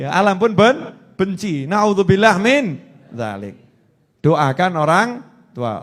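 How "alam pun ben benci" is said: ordinary delivery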